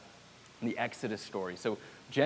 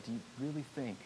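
gentle rebuke; 2 semitones lower, 10 dB quieter, much faster than usual. Retold or imitated. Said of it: "turns to them and said".

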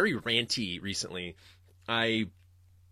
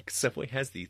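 ordinary talk

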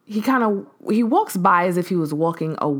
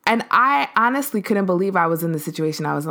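ordinary talk, nothing marked

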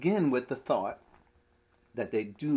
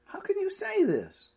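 exasperated outcry. Acted. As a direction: throw the question up in exasperation. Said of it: "Jesus is a bit exasperated here".

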